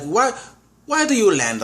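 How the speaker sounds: put-on a half-hearted try at a Russian accent; quoting a sarcastic Russian woman in a weak Russian accent. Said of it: "I can't do a Russian accent".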